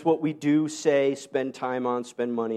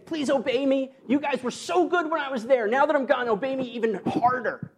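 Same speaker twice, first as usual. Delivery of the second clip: higher mock plea of an apostle; pleading Paul, hammed up; 8 semitones higher than usual. Preacher imitating Paul begging the church to obey. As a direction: play Paul begging his church, lightly mocking. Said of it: "and he's saying".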